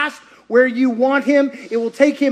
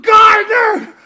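high, loud yell of Gardener; shouted call; 12 semitones higher, 9 dB louder, slower than usual. Acted. Mary calling out to the gardener, loud and high. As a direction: call out sharply, almost attacking. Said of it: "She goes".

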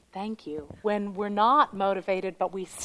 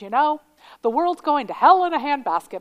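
higher, louder quoting mother; mother's saying; 6 semitones higher, 8 dB louder than usual. Retold or imitated. Mother voiced declaring doom, raised and louder. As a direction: echo mom's gloomy line, louder. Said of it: "she goes".

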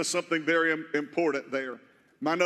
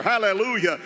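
ordinary talk, nothing marked

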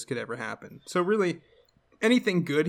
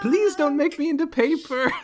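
high, loud mock pleading; screaming tree; 13 semitones higher, 5 dB louder than usual. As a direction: beg like a terrified tree, hammed-up. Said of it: "He hears the trees scream".

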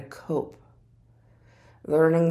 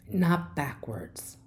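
ordinary talk

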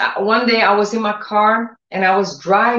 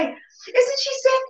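high, slow mocking sneer; mocking gossip; 13 semitones higher, 4 dB louder, slower than usual. Preacher mimics gossiping people, high mocking sneer. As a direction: imitate nosy people, pitched up and drawn out. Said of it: "People say".

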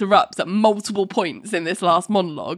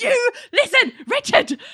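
scolding. Retold or imitated. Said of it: "it's like".

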